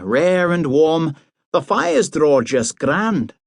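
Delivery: high, chatty Highland mother, slowing at the end; mother chattering about the new house, high and slowing. A narrator's reading. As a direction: ramble on as the mother, pitched high. Mother voiced on phone.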